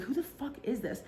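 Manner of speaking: hushed who-is-this grumble; muttered annoyance. Retold or imitated. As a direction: mutter it to yourself, irritated. Declining repeat call.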